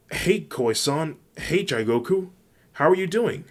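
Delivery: high, loud, bouncy character hello; high-pitched, excited girl-character voice; cheerful friend greeting. A narrator's reading. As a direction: call out a perky greeting to friends. Uraraka greets two friends in story.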